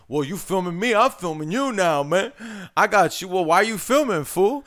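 hammed-up combative street voice; indignant passer-by. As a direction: imitate a guy defiantly challenging someone. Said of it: "And he's like".

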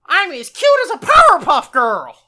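high, loud cartoon pup; squeaky cartoon boast; Scrappy voice. Scrappy in the movie boasting, high and loud. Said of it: "he's like".

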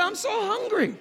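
lightly acted high-pitched hungry plea; hungry alligator. Alligator calling up to monkey in the tree, hungry plea. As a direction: plead hungrily, pitched well up.

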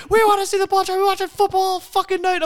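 loud, high-pitched imitation of yelling women; hammed-up shrill group complaint; mock screaming. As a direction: screech it like an outraged crowd of girlfriends. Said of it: "the girls are just like".

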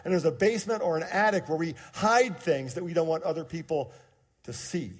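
preaching. Acted. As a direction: preach it out to the congregation.

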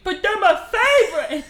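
loud, high put-on protest; Beast impression. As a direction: protest loudly like a hammed-up sulking monster. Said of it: "he says it like that".

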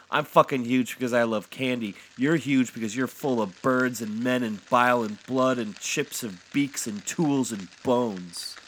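loud, high, furious listing; heated outburst, slowing on the final list; angry tirade. A narrator's reading. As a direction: rant back at a pathetic husband. Wife rants back at Santa.

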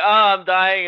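high, loud mock death cry; hammed-up dying actor. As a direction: play a bad actor pretending to die. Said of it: "trying to keep a straight face and going".

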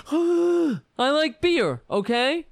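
loud, high, slow indignant shout; shouted Kavanaugh impression. Mocking Kavanaugh yelling at the senators, loud and high. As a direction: bellow it like an outraged nominee. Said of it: "Kavanaugh ... All crying about".

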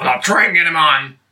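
normal talking voice

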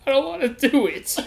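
high-pitched reluctant whine; mock freaking out. Prisoner refusing officer's order, mock freaked-out whine. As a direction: balk in a small, high voice. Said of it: "I'm freaking out".

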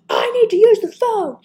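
high, loud imitation of a household holler; mock-yell it across the house; retold yelling. Retelling being on dial-up five minutes, imitated yelling. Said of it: "I was like".

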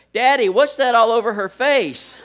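put-on high loud little girl asking; child's voice. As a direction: play a blunt toddler asking out loud. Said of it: "and then Hannah goes".

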